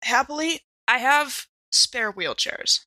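ordinary delivery

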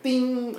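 high, ringing guitar-string ding; light, high-pitched "ding". Shown as a demonstration. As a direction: imitate a short string's high note. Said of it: "at real light".

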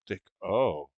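low-key, deflated "oh"; depressing. Acted. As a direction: drop it softly, let down. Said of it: "It's just very depressing".

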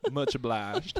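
slow Southern gentleman's thanks; Southern accent. Acted. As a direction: drawl it out slowly. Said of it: "I'm sure they say it like that".

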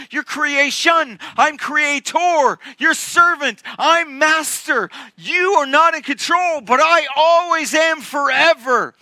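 high, forceful, ringing declaration; impassioned proclamation. Acted. God assuring Elijah of his sovereignty, fervent proclamation.

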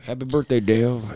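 lightly voiced Southern crooner greeting; a hint of the King in a birthday wish. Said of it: "just talking like [name]".

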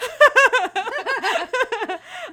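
high, loud burst of laughter. Real, not acted. Host laughs at the joke.

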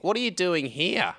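high, put-on nosy question; high comic send-up of a question; comical Wayne voice. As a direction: mimic a colleague's baffled small talk, pitched well up. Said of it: "he's like".

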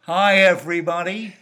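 over-the-top American dad greeting; loud, cheesy American sitcom dad; American dad accent. Pretend. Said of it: "my impersonation of an American dad".

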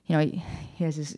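ordinary talk

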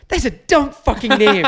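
high, loud exasperated outburst; retold fit of rage. Dad reacting to the dog's name, imitated rage. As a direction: imitate a furious father. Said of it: "in a fit of rage".

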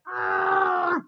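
mock moan. Moaning mid-sentence reading.

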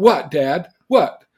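loud, rising mock-indignant challenge; defensive kid's retort. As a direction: imitate a child snapping back at dad. Acts child reacting to dad's look.

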